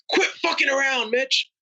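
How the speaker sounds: high-pitched mock yell, no real volume; retold yell. Mentor yelling at a dawdling student pilot, high imitated yell. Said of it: "get really close to me and yell".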